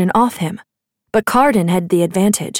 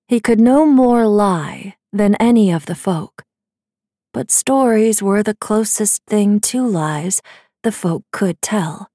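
ordinary talk, nothing marked

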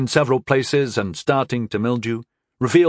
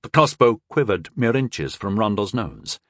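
plain reading voice

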